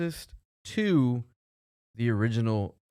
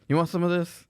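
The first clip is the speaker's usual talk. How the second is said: guy offering rub around; 8 semitones higher, 3 dB louder than usual. Retold or imitated. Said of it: "I'm the guy walking around".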